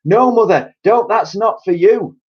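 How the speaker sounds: high, loud urgent plea; urgent mother. A narrator's reading. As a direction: stop her drinking. Mother stopping grandma.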